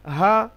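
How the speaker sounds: হা said light, from the top of the throat; easy breathy হা; হা with no strain at all; soft ha. Shown as a demonstration. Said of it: "হলকের শুরু হতে সহজে আওয়াজ হবে".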